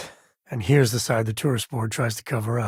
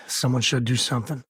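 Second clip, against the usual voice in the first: ordinary talk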